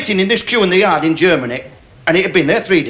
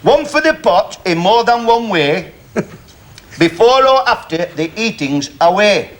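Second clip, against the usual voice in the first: recited rhyme; 3 semitones higher, slower than usual. Real, not acted. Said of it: "Beautifully said".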